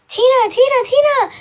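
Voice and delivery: loud, high shouting of a name; calling out. Retold or imitated. Cousins shout for their aunt, loud and high. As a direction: yell for her across the house.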